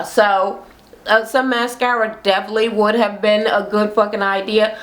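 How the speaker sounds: ordinary delivery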